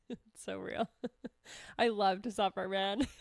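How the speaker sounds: low, soft laughing confession. Real, not acted. Admitting she loves to suffer, laughing. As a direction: laugh through the words, voice dropped.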